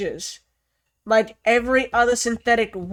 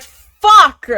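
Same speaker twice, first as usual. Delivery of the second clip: loud, frustrated outburst; frustrated curse; 9 semitones higher, 12 dB louder than usual. Retold or imitated. Reacting to the android's new directive, loud curse. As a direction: blurt it out in frustration. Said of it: "And I'm just like".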